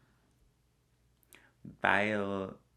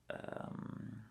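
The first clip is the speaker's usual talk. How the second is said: ordinary talk, nothing marked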